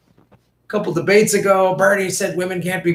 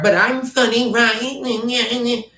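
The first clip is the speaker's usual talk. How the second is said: mocking Klobuchar; 8 semitones higher, much slower than usual. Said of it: "you know, Klobuchar".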